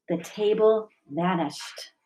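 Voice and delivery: ordinary delivery, nothing marked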